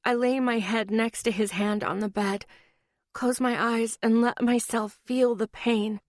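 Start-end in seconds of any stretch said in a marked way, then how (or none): none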